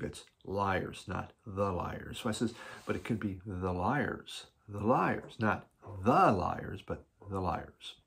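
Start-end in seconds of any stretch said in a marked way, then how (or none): 0.0-2.2 slow, with "the" in "not the Liars" stretched and stressed; retold, with "the" drawn out; quoted stress on THE. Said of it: "that's how you put that emphasis there". 2.8-7.9 "could" rises, then "the" in "not the Liars" is slow and stressed; teasing run of stress on "the", slowing down; playful shifting stress. Retold or imitated. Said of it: "that's how you put that emphasis there".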